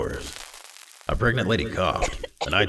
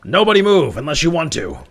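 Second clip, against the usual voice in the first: much higher and louder barked order; police command; 13 semitones higher, 10 dB louder than usual. Acted. Detective orders the office workers, loud command. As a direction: freeze the room with a cop's shout.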